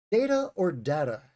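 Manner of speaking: "data" first as DAY-tuh, then as DAT-uh; contrasting pronunciations. Shown as a demonstration. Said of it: "Let's talk about that word".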